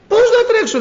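very high, loud protest; desperate cry. Retold or imitated. Blind man crying back to the villagers, high and loud. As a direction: cry out that you can't possibly run. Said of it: "λέει".

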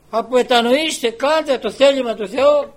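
loud, high preacher's shout; prophet crying out. Retold or imitated. Elijah calls Israel to repentance, crying out. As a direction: cry it out to a whole nation. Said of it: "φώναζε".

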